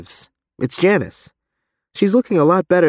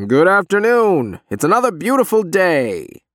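loudspeaker announcement; 7 semitones higher than usual. Acted. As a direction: announce it through a crackly speaker. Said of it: "box crackles and begins to shout".

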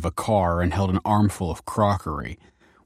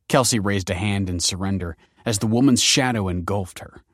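ordinary delivery, nothing marked

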